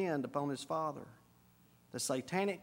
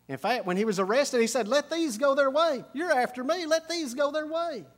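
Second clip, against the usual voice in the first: higher, louder proclaiming of Jesus' words; preaching at full force; 6 semitones higher, 4 dB louder than usual. Acted. Preacher voicing Jesus shielding his disciples at the arrest, louder. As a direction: cry it out for the crowd.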